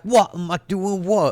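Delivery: a hammed-up English reply to a challenge; imitated English accent. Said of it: "put on the accent".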